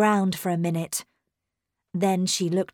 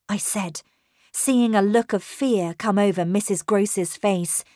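plain reading voice

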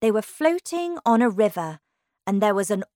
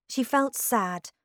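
plain reading voice

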